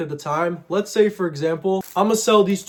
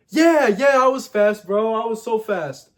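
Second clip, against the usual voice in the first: a much higher, louder bragging yeah yeah; eager boast; 5 semitones higher, 4 dB louder than usual. Retold or imitated. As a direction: oversell being fast to the kids. Boasting lie to kids.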